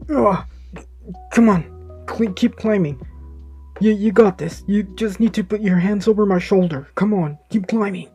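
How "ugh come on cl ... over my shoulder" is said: straining effort. Acted. Deku holding falling car midair.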